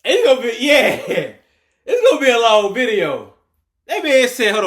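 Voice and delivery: loud, high-pitched cry through laughter; really cracking up, voice shooting way up; excited laughing outburst. Real, not acted. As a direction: crack up and shout over the laughing. Cracking up at the clip.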